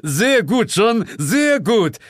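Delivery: high, loud, slow praise; drawn-out superior's commendation; Sir James. A narrator's reading. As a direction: boss applauding with pointed relish. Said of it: "erklang Sir James' Stimme unter mir".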